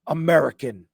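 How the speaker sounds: loud, punched single word; emphatic deli order. Retold or imitated. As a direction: belt out the cheese order. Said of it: "I go like this".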